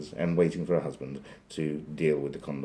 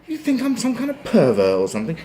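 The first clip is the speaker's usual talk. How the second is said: very high, loud indignant duck; hammed-up high-pitched indignation; mock outrage; 10 dB louder than usual. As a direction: shout it high, as an affronted duck. Duck's outraged punchline to receptionist.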